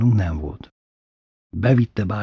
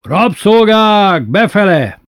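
high, loud, drawn-out yell; tyrant's long roaring call; bellowed summons; 10 semitones higher, 8 dB louder, slower than usual. A narrator's reading. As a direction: bellow for the slaves to come. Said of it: "s már ordított is Bátyó".

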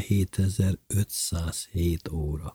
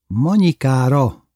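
raised, drawn-out cry; called-out toast; 5 semitones higher, 5 dB louder, slower than usual. A narrator's reading. Lili toasts Bubu's wife across the table, calling out. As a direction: call it out slowly, higher and louder. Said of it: "kiáltotta Lili".

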